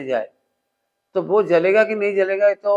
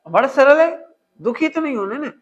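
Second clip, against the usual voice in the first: excited; 6 semitones higher, 5 dB louder than usual. Real, not acted. Preacher exclaims.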